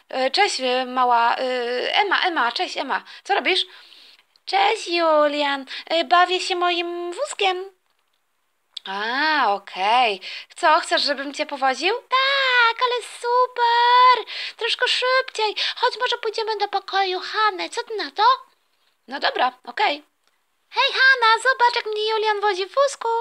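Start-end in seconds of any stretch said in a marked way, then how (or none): 4.4-7.8 slow, higher little sister voice; little sister answering her brother, small girl voice. Acted. Little sister Ema greets brother. 11.9-18.2 much higher, squealing little sister voice; a delighted small child being pushed in a pram; little sister thrilled by the pram ride, high child voice; excited little girl. Acted. 20.7-23.1 put-on much higher, louder child voice calling out; little sister boasting to Hanna, high excited child voice; excited little girl.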